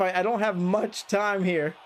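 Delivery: normal talking voice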